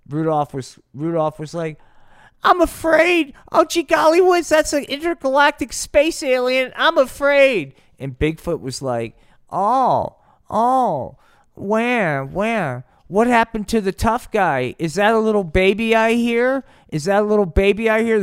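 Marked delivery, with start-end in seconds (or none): 2.4-7.7 very high, loud cartoonish panic; hammed-up frightened wail. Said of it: "once he saw Rudolph fucking cry". 9.5-18.2 high, loud jeering; baby-talk mockery. Bigfoot taunts crying Rudolph.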